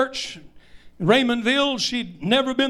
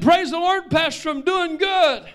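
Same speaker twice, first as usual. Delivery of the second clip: higher, bright voice for the sweet new convert; cheerful lady; 8 semitones higher than usual. Retold or imitated. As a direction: voice her happy and eager.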